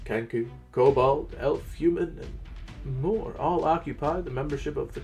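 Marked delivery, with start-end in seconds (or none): none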